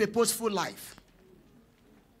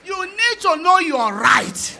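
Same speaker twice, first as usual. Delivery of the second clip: loud, high, slow exhortation; preaching shout; 8 semitones higher, 8 dB louder, slower than usual. Real, not acted. Preacher exhorting listeners to know their rights, a slow loud shout. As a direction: drive the point home to the crowd.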